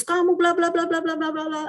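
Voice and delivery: mock a teacher nagging nonstop. Teacher lecturing a misbehaving pupil, nagging mock scold. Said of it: "pokoknya gurunya cerewet lah".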